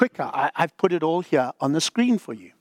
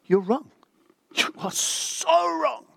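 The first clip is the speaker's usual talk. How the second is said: exasperated prophet scolding Job; Elihu's indignation; 9 semitones higher than usual. Retold or imitated. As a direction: pitch soars on "so wrong", not shouted. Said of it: "watch what Elihu is going to say".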